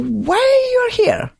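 very high, slow and drawn out. Real, not acted. Host asking a young girl why she came, very high and slow.

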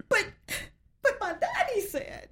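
very high, halting mock protest; hammed-up little-girl pleading. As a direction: squeak out a naive objection in halting bits. Acts her naive protest, then laughs.

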